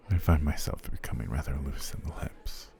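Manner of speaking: ordinary talk, nothing marked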